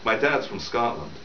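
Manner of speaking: usual speaking voice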